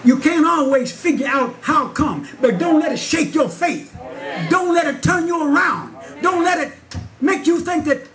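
preaching at full force. Acted. Preacher retells the pastor's rebuke to his church, preaching. Exhortation to the congregation.

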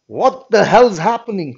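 loud, high alarmed demand. Acted. Detective arriving at the prison demanding answers, loud and alarmed. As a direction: burst out at the governor.

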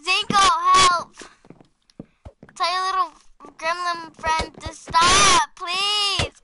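loud, drawn-out cry for help; pleading yell. Real, not acted. Calling for help under attack.

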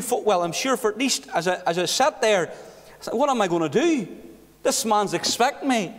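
normal talking voice

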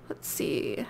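whispered to herself; soft whisper to self. Real, not acted. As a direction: breathe it under your breath. Muttering to self while searching.

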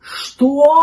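outraged dog's exclamation; indignant dog. A narrator's reading. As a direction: burst out in indignation. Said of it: "возмутился пёс".

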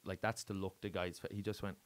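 normal talking voice

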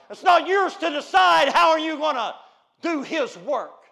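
loud, slow, building pulpit cry; loud preacher's cadence; preaching. Acted. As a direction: thunder it from the pulpit. Preaching at full force.

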